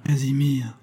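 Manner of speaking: pleading grieving call; broken voice. A narrator's reading. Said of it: "murmure d'une voix entrecoupée".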